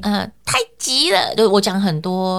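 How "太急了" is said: high, tight and piercing; sharp tight voice. Shown as a demonstration. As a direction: strain the voice up and sharp. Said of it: "我就会掐着脖子讲".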